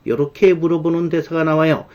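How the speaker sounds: ordinary talk, nothing marked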